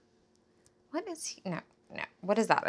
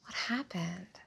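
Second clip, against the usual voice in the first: very soft, near-whispered question; hushed concern; 2 semitones lower, 11 dB quieter, slower than usual. Acted. Asking after the relative's nervous breakdown, hushed. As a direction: ask it gently under your breath.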